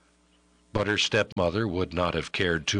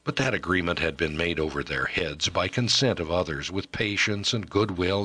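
usual speaking voice

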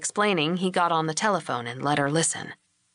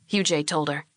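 ordinary delivery, nothing marked

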